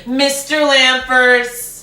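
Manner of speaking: slow, high Mister Lamfers, drawn out; mock teacher. Friend imitating a teacher addressing a six-year-old, slow and high. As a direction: say it like a stiff teacher.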